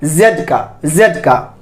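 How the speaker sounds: "जेड" with a buzzing z; emphatic zed; Z sound. Shown as a demonstration. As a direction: say "जेड" as "zed", low and falling. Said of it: "किसका ध्वनि आ रहा है".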